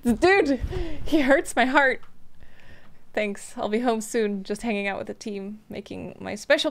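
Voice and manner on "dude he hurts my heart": higher, louder, overcome; emotional loud gush; touched outburst. Real, not acted. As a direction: blurt it out, heart melting. Touched by dad's text.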